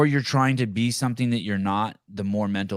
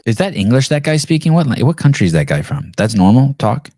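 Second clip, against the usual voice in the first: much louder, incredulous questions; loud mocking disbelief at the speaker on the clip; 2 semitones higher, 11 dB louder than usual. Real, not acted. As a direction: disbelief at the accent, nearly shouting. Mocking news interviewee's accent.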